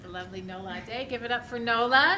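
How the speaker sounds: ordinary delivery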